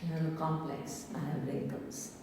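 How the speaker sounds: low, soft inner complaint; imitated self-criticism, subdued and low; self-critical voice. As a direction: quietly voice someone's self-doubt. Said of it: "Self-criticism, self-non-acceptance".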